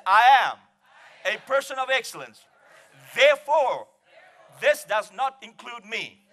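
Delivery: loud, high, phrase by phrase; ringing pulpit proclamation; call-and-repeat declaration. Real, not acted. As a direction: lead the congregation to repeat it boldly. Leads congregation in repeating a declaration.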